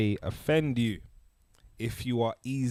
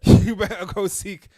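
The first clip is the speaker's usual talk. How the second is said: ordinary talk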